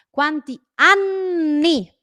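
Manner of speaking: "Quanti anni" very slow, loud, high, N held in "anni"; pause on double N. Shown as a demonstration. Teacher showing how she pauses, exaggerated double N. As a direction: stop on the long N of "anni". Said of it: "hago una pausa".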